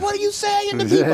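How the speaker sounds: very high, loud mock squeal of the wife; wife's shocked squeal. Husband mimicking his wife's outrage at his joke, shrill and loud. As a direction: shriek it like an embarrassed wife. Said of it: "And she's like".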